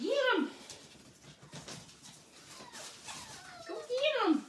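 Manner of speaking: egging the puppies on, high and eager; cheering the dogs on. Real, not acted.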